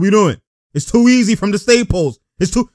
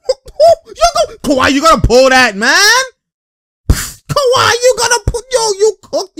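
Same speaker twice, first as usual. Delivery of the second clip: high-pitched, worked-up courtside shouting; excited yelling; 12 semitones higher, 5 dB louder than usual. Real, not acted. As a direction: yell at the player in disbelief. Yelling in excitement at Kawhi's play.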